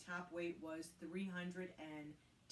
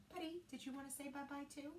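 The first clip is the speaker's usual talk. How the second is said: higher, hushed pet talk; coaxing the dog; 8 semitones higher, 5 dB quieter than usual. Real, not acted. Asking Buddy the dog to say bye to viewers, coaxing. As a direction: gently coax the dog to wave goodbye.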